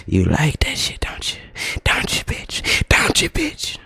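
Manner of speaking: breathy, unvoiced, slow taunting whisper; mock taunting whisper. To the rude customer, a mock taunting whisper. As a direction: hiss the taunt at her, then jump high on the last.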